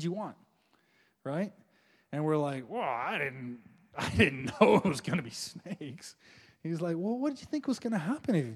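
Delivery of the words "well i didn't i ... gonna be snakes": low, slow mumbled excuse with a laugh; sheepish excuse. Pretend. People excusing themselves to God, sheepish and laughing. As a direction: make a lame, embarrassed excuse.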